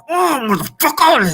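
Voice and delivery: drawn-out high groan, slowly, like someone just punched in the chest; hammed-up wince of pain, stretched out; mock pained groan. Imagined punch victim groaning.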